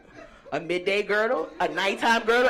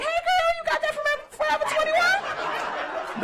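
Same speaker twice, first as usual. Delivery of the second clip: high, drawn-out mock compliment; gushing girlfriend. Shopper asking a girlfriend about her shirt, high gushing. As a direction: squeal it slowly like an excited friend.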